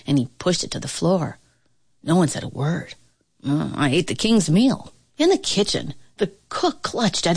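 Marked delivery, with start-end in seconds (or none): none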